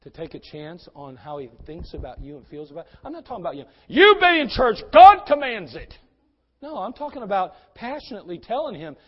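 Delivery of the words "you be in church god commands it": much louder and higher, a barked demand; harsh shouted command. Pretend. Preacher mocking a pushy believer ordering a newcomer, shouted command. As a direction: yell it like a bossy preacher ordering him.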